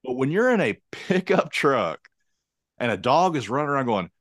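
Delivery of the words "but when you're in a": normal talking voice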